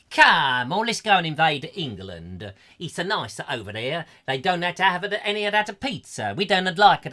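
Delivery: hammed-up Italian Roman; Italian accent. Roman urging an invasion of England, mock Italian accent. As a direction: put on a comic Italian accent. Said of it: "Italian Roman".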